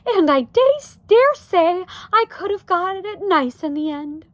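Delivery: high, loud, plaintive wife; fretful Homley. A narrator's reading. Wife regrets the lost home, fretful Homley. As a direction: voice Homley well above the narration.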